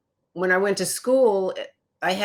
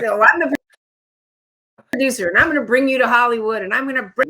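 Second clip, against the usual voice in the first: imitating the producer higher, louder and fast; boastful producer; 6 semitones higher, 6 dB louder, faster than usual. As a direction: puff up like a big-shot producer. Producer quoted, retold meeting.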